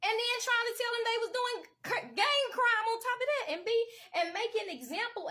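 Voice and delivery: high, loud outraged cry. Real, not acted. Ranting to viewers, outraged and loud. Angry rant about prosecution.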